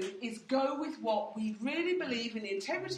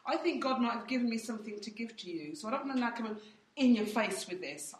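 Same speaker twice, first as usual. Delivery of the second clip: normal talking voice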